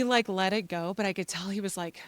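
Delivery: ordinary talk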